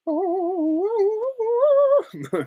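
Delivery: very high sung trill; high soprano giggle trill; Glinda trill. Retold or imitated. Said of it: "like when she does the".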